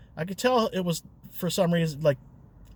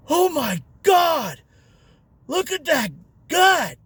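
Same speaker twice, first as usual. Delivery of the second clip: put-on drunk blurt; 11 semitones higher, 5 dB louder, slower than usual. Drunk blurting about driver's belly.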